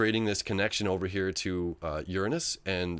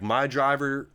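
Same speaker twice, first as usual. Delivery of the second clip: Ricky Bobby southern; 3 semitones higher, 4 dB louder than usual. Retold or imitated. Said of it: "I just went into a Ricky Bobby thing".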